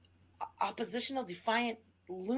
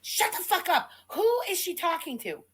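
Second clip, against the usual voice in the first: angry outburst; 6 semitones higher than usual. Real, not acted. Cohost yelling at the streamer, angry. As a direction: cut her off, fed up and angry.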